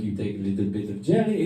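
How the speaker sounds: low, soft French friend impression; deep, hushed Frenchman; French accent. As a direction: quote him in a lightly French accent. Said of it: "so he's like".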